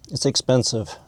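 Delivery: usual speaking voice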